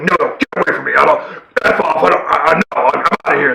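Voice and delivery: mock agitated, sputtering brush-off; stammered, hammed-up rebuff; gruff refusal. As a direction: imitate a grouchy hero pushing someone away, rushed and rough. Retold Wolverine refusing the woman.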